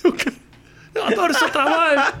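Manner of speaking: high-pitched gushing through mock pain; injured starstruck fan. Injured driver recognizing the comedian who hit him, starstruck groaning. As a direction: fawn and moan at once, comic imitation. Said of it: "eu sou um cara conhecido".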